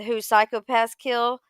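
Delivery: usual speaking voice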